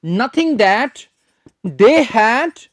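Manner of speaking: very slow, word by word; slow dictation. Shown as a demonstration. Teacher dictates the phrase slowly for note-taking. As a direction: spell it out slowly for students to write. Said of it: "लिख लीजिए students".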